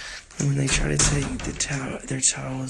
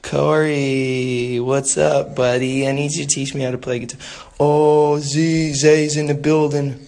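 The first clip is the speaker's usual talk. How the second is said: loud greeting; 9 dB louder than usual. Real, not acted. Streamer hailing viewers as they join, loud greeting. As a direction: call out loudly, stretching the names.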